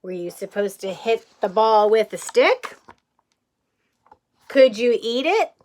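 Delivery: ordinary talk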